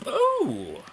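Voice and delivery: sudden high yelp of surprise; surprised oh. Real, not acted. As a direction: jolt up in pitch, caught off guard. Sudden game event.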